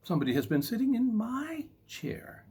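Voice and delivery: high whispered mama bear; breathless high whisper of a mother bear. Acted. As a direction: hiss it out in a high hush. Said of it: "said Mama Bear".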